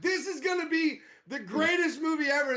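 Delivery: high-pitched retold excitement; excited. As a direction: gush as if recalling first thrill. Retelling own excitement at opening scene.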